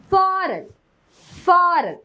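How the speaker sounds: "foreign" with a long drawn-out "aa" in the first syllable; long AA sound. Shown as a demonstration. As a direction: stretch the vowel in "foreign" to faa-ren. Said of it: "long ആ sound ആണ് കൊടുക്കുക". ("foreign" നീണ്ട ആ ശബ്ദത്തിൽ)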